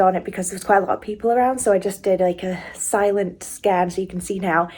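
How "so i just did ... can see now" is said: ordinary talk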